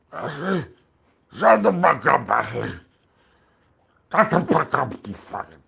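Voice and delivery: high, loud barking shut up; hammed-up unhinged yell; Mister Kill impression. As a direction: snap at her in a manic character voice. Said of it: "Mister Kill impression".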